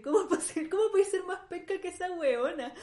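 high, loud indignant outburst; outraged. Real, not acted. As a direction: shout it in disbelief. Outraged comparison with Thatcher.

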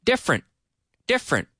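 DIFFERENT as DIF-rent, high and short; two-syllable pronunciation. Shown as a demonstration. Teacher drilling the word for learners, two syllables. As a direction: say different without its middle vowel. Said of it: "Two syllables".

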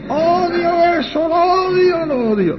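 high wailing repeats, easing down at the end; imitated possessed scream. Possessed girl answers the little priest, wailing high in mock screams. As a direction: mock-scream like one possessed, vowels drawn out. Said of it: "gritando con esa voz".

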